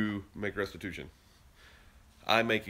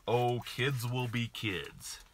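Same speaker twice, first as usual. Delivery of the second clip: mocking other parents; 3 semitones higher, much slower than usual. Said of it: "what I hear a lot here".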